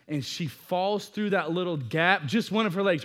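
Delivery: usual speaking voice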